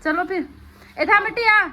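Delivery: calling out. Real, not acted. Mother hurries the family to leave, loud calling out. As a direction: call out sharply to get them moving.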